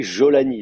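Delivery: JOLANI with a very soft opening j; soft Syrian j. Shown as a demonstration. Teacher showing Syrian pronunciation, soft j in JOLANI. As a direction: lean on "Jolani", its j smooth as in French. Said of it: "très doux, comme ça".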